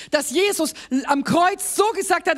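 ordinary talk, nothing marked

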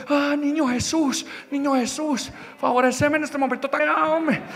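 high, soft mock plea; hushed high-pitched begging to heaven, hammed up; anxious prayer. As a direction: pray in a small, high, frightened voice. Acted frightened prayer on the edge.

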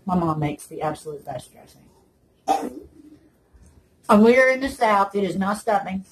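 speaking with some food left; chewing between words; talking after a bite. Real, not acted. Seen 2: middle woman chewed just before, holds loaded fork while speaking.

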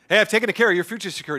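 rushed, offhand delivery; brisk reassurance. Acted. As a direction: toss it out fast, a mock impersonal promise. Mock brisk version, then rejected.

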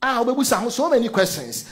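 ordinary talk, nothing marked

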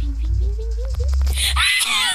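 high, loud frantic cry; panicking gamer. Real, not acted. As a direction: shriek as you're caught. Getting caught by the killer.

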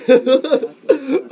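high, loud cackling wail; a high, loud laugh-sob; high laughing cry. Acted.

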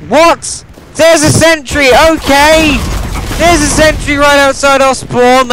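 high-pitched, incredulous complaint; indignant disbelief. Real, not acted. Gamer finding a sentry outside spawn, indignant.

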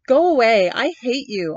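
put-on boy screams at grandfather; shouting. A narrator's reading. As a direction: hurl it at grandfather in a tantrum. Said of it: "On shouted".

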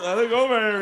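ordinary talk, nothing marked

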